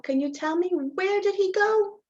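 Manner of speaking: usual speaking voice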